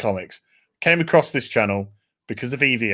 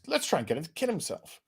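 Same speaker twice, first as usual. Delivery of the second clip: normal talking voice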